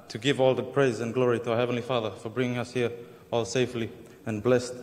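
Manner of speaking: usual speaking voice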